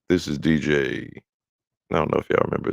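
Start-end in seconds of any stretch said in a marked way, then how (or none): 0.1-1.1 slow, drawn-out DJ intro; radio DJ voice. Retold or imitated. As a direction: announce it like a radio DJ. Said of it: "a real D J".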